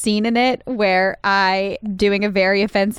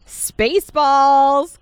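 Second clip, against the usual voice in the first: excited; 4 semitones higher, 4 dB louder, much slower than usual. Real, not acted. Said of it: "'cause I love that movie".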